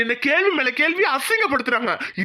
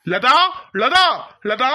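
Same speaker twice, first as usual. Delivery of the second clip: calling out; 3 dB louder, much slower than usual. Acted. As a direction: call her name loudly from outside. Husband calls wife from outside. (மனைவியை வெளியிலிருந்து சத்தமாக அழைத்தல்)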